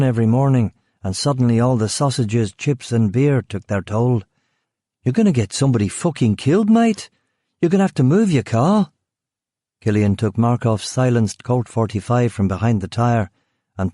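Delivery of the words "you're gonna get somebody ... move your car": exasperated Brummie guard. Acted. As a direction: scold him like he's an idiot. Guard demands he move car.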